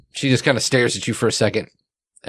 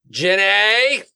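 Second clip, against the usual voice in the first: high call across the room; 9 semitones higher than usual. Acted. Teacher calls Jenny from across room, high call. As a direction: call her name from afar.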